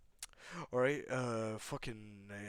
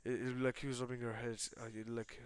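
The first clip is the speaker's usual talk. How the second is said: ordinary delivery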